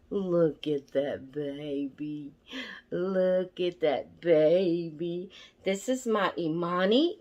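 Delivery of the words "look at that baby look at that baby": adoring coo. Real, not acted. Showing viewers her baby doll Imani, cooing adoringly. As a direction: coo it slowly, savouring each word.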